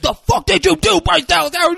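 very high, loud screeched accusation; mock enraged scream. Pratt grabs Howard and blames her, hammed-up screaming. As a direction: scream it way over the top. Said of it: "And he grabs".

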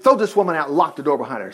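ordinary delivery

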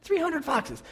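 incredulous repeat. Real, not acted. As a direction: say it like you can't believe it. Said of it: "incredulous repeat".

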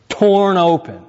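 "torn" hit high and loud, open falling; stress on torn. Shown as a demonstration. Pastor points the congregation to "torn", punched high. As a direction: lean hard on "torn" to make them look. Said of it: "look at this".

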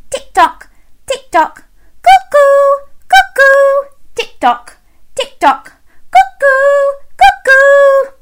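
loud tick-tock with high cuckoos; clock sounds. Shown as a demonstration. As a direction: lead the children in the clock. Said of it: "do that one as well".

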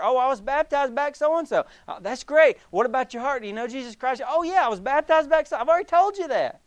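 exasperated churchgoer. Acted. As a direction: brush the pastor off, fed up. Impatient churchgoer, asked again.

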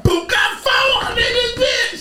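high excited shout, building; hyped yell. Retold or imitated. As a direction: hype it up like a gamer on a streak. Hyped kill count, shout.

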